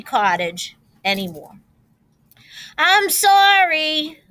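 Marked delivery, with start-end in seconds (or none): 2.8-4.2 slow, higher, louder motherly apology; a hint of a storybook mother, drawn out; lightly voiced mother. A narrator's reading. Mother to her three pigs, lightly voiced apology. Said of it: "said their mother".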